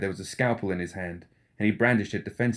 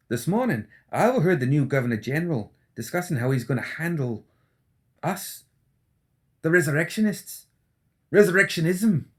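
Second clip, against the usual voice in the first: higher, jittery anxious doctor; McMorning, nervous; 8 semitones higher than usual. Acted. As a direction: fret that someone is listening. McMorning voiced.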